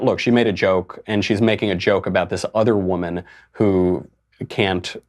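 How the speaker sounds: ordinary talk, nothing marked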